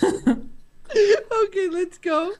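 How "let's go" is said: usual speaking voice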